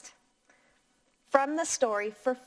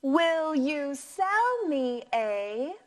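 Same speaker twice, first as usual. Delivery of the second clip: slow, rising question voice; rising question tune; slower than usual. Shown as a demonstration. Teacher modelling a question for the class, slow rising tune. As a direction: turn the voice up at the end. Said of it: "let's practice one more time".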